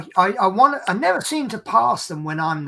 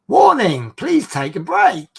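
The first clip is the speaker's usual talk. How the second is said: loud, flat robotic announcement; camera warning voice; 2 semitones higher, 9 dB louder, slower than usual. Retold or imitated. The dashcam warns the drowsy driver, loud and robotic. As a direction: read it like an automated alarm.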